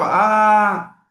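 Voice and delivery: a long, open "A" said loud and clear; vowel sound A. Shown as a demonstration. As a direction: show the child the sound "A". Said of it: "tem esse som aqui".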